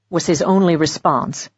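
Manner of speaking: usual speaking voice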